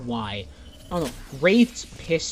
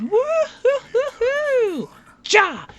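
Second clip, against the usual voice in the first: gleeful, much higher, loud whooping yell with a giddy laugh; happy Octane imitation; 15 dB louder, much slower than usual. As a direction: whoop it up like a maniac cheering over a kill. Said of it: "he sounds so happy".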